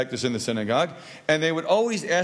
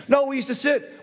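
high, insistent mock contradiction; arguing; 9 semitones higher than usual. Sitting faction contradicts the standers, high mock bickering. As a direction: contradict sharply with pitch raised. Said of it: "They argued".

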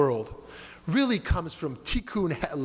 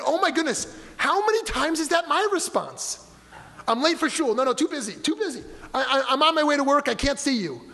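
mock busy person; 7 semitones higher than usual. Rabbi mimics his own excuses to the congregation, hammed-up and higher. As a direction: play a rushed self-important person brushing someone off.

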